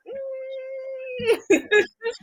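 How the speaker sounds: high screechy fax machine noise. Pretend. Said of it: "doing my fax machine noise".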